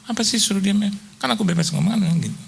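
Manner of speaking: wife arguing with her husband, mock indignant; imitating his wife. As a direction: play the wife protesting she may talk. Wife's voice quoted in story.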